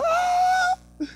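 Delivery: very high, loud, frantic worrying; panicked worrier. Retold or imitated. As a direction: fret in a high panicked squeal. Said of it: "and you're like".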